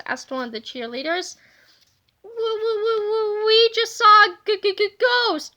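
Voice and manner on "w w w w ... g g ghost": high, stammering panic, each word stuck; stuttering fright. A narrator's reading. George stammers to the cheerleaders about a ghost, panicked stutter. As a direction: stammer out the ghost sighting, terrified. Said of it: "cried George".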